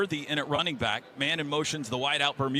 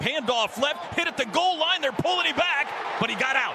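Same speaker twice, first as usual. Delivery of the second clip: shouted play-by-play, much higher; excited call; 8 semitones higher than usual. Real, not acted. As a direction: yell the goal-line fight as it happens. Goal-line run called live.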